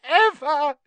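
high, loud elf bawling each word; wailing. A narrator's reading. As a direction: wail every word out separately, at full pitch. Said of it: "he wailed".

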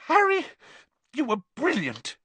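very high squeaky voice. A narrator's reading. Hermione rushing in to praise Harry, squeaky. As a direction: squeak it out with relief. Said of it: "Hermione said squeakily".

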